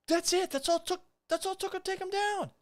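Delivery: very high, sneering disbelief; high mocking repeat of the takedown. Real, not acted. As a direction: ridicule how easily he fell. Mocking replay of the same fall.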